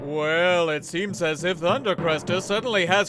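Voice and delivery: dramatic radio serial narration over music; serial narrator. A narrator's reading. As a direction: set the scene like an old radio announcer.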